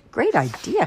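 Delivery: pleased storybook mother, voice lifted; delighted approval. A narrator's reading. Mother approving her daughter's plan, delighted.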